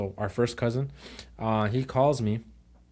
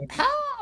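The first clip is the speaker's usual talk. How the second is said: very high excited squeal; high squeal of delight. Retold or imitated. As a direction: squeal like you just saw something amazing. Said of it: "like that".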